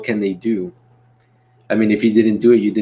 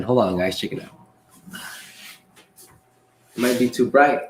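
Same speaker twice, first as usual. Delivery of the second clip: usual speaking voice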